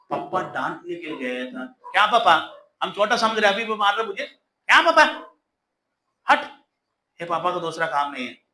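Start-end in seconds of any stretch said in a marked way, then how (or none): 1.7-8.4 defiant son talking back. Acted. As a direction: shout back at dad, offended. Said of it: "ऐसे बोला ही तो". (बाप को ऊँची आवाज़ में पलटकर जवाब देता बेटा)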